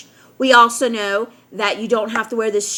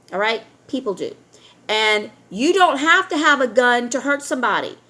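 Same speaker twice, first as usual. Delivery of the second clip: normal talking voice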